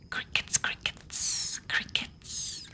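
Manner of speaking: very soft, voiceless ASMR whisper. Real, not acted. ASMR host naming the background cricket sounds, whispering. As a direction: whisper it as a tiny repeated echo.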